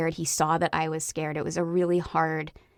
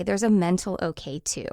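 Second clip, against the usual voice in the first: ordinary talk, nothing marked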